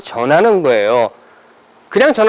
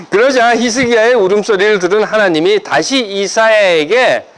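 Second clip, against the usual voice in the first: ordinary delivery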